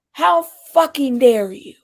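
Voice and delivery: loud, slow mock outrage; slow, forceful, deadpan affront; stone-faced indignation. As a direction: imitate her indignation, leaning hard on each word. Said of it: "stone face".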